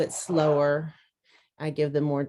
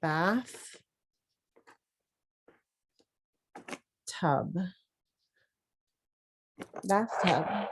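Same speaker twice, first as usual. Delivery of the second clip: softly, "bath" and "tub" apart with long pauses, then "bathtub" whole; syllables split; 6 dB quieter, much slower than usual. Shown as a demonstration. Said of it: "have them rewrite it".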